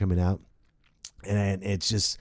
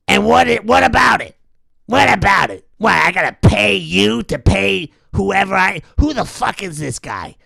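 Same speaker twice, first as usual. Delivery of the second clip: loud mogul voice; 7 semitones higher, 6 dB louder than usual. Retold or imitated. Weinstein shouting.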